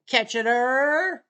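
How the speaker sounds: Kitchener stretched out slowly and loud; Kitchener taunt. Acted. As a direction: call out Kitchener like a fan. Repeats his Kitchener taunt.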